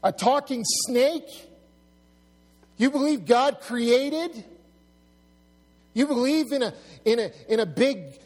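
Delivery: high, loud incredulous jeering; acted scornful questions, pitched well up; mocking disbelief. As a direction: imitate a scoffer ridiculing a belief. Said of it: "You're gonna get mocked".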